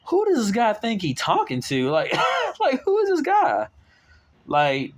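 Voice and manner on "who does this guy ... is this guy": way up high with a laugh breaking through; high incredulous, laughing. Retold or imitated. Mocking the big guy who confronted him, high and laughing. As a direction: squeak it out in amused disbelief. Baffled by a hostile customer.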